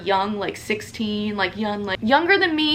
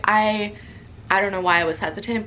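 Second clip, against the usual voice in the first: ordinary talk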